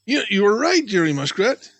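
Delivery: a touch of stammering surprise; cried out. A narrator's reading. Little Joe realising Jerry is right, crying out. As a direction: blurt it, stumbling at first. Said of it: "he cried".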